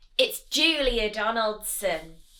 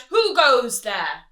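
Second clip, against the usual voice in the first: loud, bossy highway rat; villain rat; 5 dB louder than usual. Acted. Highway rat demanding food from the rabbit, loud and bossy. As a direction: bark demands like a bully bandit. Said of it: "who cried out".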